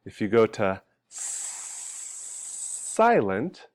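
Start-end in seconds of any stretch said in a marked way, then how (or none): 1.1-3.7 long hissing "s-" before "silent"; drawn-out S sound. Shown as a demonstration. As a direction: hold voiceless "s-", then say "silent". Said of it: "it doesn't".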